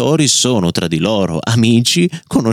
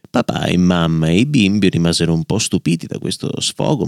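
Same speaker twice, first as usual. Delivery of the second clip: usual speaking voice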